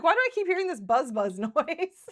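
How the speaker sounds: giggling through the quote; laughing. Retold or imitated. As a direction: laugh while asking it. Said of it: "I'm like".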